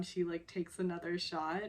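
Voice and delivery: ordinary talk, nothing marked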